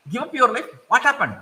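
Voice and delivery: the little crab can't believe it; alarmed crab. Acted.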